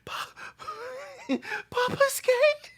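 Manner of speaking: slow, high laughing cry; laughing disbelief. Real, not acted. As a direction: squeal it out laughing.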